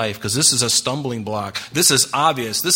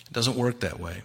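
ordinary talk

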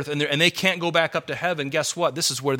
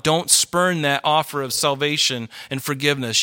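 normal talking voice

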